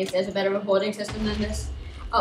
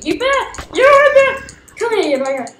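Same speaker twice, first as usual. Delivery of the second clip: high, loud mock challenge; taunt; 4 semitones higher, 7 dB louder than usual. Real, not acted. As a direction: goad the enemy. Taunting opponent.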